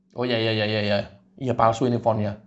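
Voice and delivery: low, soft, meek nodding along; meek low agreement. Acted. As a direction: drop low and give in. Rismond meekly agreeing.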